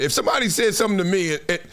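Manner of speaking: usual speaking voice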